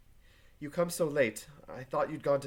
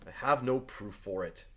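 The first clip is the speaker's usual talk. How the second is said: ordinary talk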